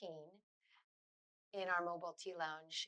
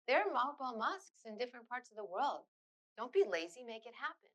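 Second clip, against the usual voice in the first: higher-pitched, emphatic imitated retort; mock chiding; 6 semitones higher, 4 dB louder than usual. Refugee women urging the artists on, mildly chiding. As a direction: lightly imitate someone pushing a doubter into action.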